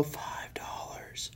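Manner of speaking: whispered, breathy repeat of the price. Real, not acted. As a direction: whisper it in stunned disbelief.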